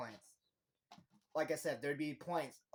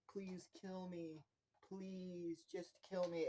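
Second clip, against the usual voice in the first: soft, drawn-out begging, pitched up; quiet plea; 4 semitones higher, 7 dB quieter, slower than usual. Retold or imitated. Nikki briefly herself, begging while the demon sleeps, softly pleading. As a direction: beg slowly in a small high voice. Voicing a possessed girl's plea.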